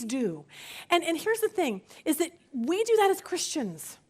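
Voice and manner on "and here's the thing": ordinary delivery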